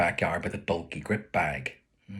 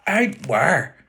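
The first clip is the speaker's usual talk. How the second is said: high, louder call after a leaving son; a mother's demanding call down the path; calling after someone; 8 semitones higher, 6 dB louder than usual. Retold or imitated. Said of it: "she calls after".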